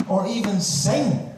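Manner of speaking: high, slow, drawn-out invitation; mock rousing. Host invites the audience to sing, high and slow before the joke. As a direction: lead the crowd on as if inviting them. Comic build to punchline.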